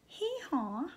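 put-on a small donkey-girl hee-haw; dainty little donkey bray. Shown as a demonstration. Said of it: "in your best little girl donkey voice".

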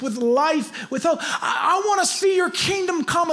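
high, urgent plea building to a near shout; impassioned prayer. Acted. Preacher voicing the congregation's plea to God, impassioned prayer. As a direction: plead at a high pitch, pushing harder to the end. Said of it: "We're literally saying".

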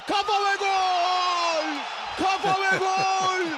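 high, drawn-out goal yell; screaming football commentator. Real, not acted.

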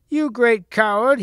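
high, loud, slow taunt; jeering. Acted. Percival taunts the knight who missed him, jeering. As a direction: draw out the insult mockingly. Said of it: "he jeered".

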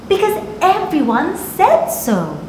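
usual speaking voice

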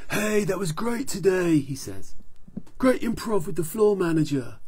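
loud, high, beefy hero voice; big friendly praise from a tough guy; hearty action hero. Acted. Action Man cheering up Doctor Z, hearty and loud.

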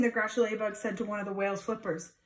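plain reading voice

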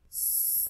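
unvoiced hissed "S"; hissing S sound. Shown as a demonstration. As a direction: hiss the "S" like a snake. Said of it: "las sílabas de la s". (sonido de S siseado)

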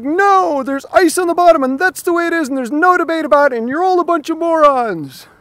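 high, loud, fast mock tirade, slowing on the insult; hammed-up emphatic rant. Dieter shouting down critics who say otherwise, in a loud, high mock rant. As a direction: shriek it like a stubborn zealot losing patience. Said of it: "Sorry, I'm getting a little emphatic".

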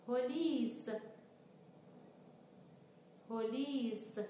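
each word read slowly and clearly, twice; slow teaching read. Shown as a demonstration. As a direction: read each word carefully for learners to repeat. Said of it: "کلماتی رو که در اونها س به کار رفته رو بخونیم". (خواندن آرام و شمرده برای تکرار)

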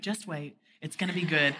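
hushed reassurance. Retold or imitated. Boyfriend answering her kiss question, soft and low. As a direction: hush her with a calm promise.

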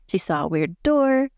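normal talking voice

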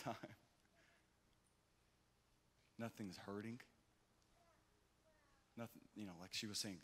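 hushed preaching of the miracle; soft tender sermon voice. Acted. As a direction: draw them in gently.

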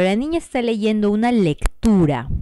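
ordinary delivery

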